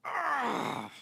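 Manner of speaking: a sudden high, loud growl of frustration; a mock roar of pent-up anger; retold frustrated roar. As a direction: let out a raw cry of rage. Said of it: "like that".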